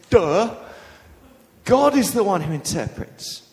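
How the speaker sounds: cross duh. Retold or imitated. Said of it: "would have got cross".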